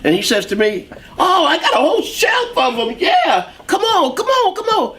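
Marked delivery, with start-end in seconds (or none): 1.2-5.0 high, laughing salesman voice, eager to sell; a jolly clerk waving him to the back shelf; excited store clerk. Retold or imitated. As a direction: shop clerk showing off his whole shelf of saxophones, laughing and high-pitched.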